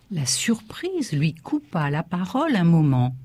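usual speaking voice

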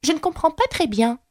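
voix de petite fille, plus aiguë, polie; Alice polie. A narrator's reading. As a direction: demander poliment d'une voix de fillette. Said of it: "aussi poliment qu'elle le put". (Alice, polie, voix de petite fille aiguë)

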